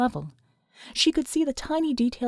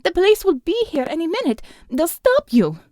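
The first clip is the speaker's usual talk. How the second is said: frightened plea; 7 semitones higher, 4 dB louder than usual. A narrator's reading. Alma pleads.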